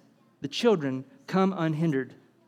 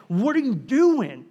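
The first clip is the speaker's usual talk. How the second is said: slow, higher-pitched indignant demand; acted anger, incredulous at his followers; mad; 6 semitones higher, 4 dB louder than usual. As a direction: draw out an exasperated rebuke. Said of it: "He's mad".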